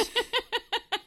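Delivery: high, loud laugh; burst of laughter. Real, not acted. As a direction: cracks up at the joke. Reacting to joke.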